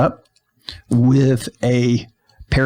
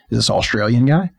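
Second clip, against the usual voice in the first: ordinary talk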